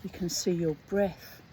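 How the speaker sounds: slow, deliberate reading aloud; story narration. Acted. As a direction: read the story slowly and evenly. Reads the short story aloud.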